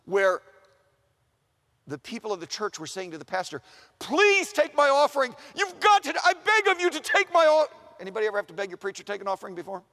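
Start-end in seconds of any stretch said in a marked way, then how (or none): 4.0-7.7 high, loud, insistent plea; desperate begging. Acted. Church people plead with the pastor to take their offering, begging loudly. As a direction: beg as if they might refuse you. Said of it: "Sometimes the pastor's begging".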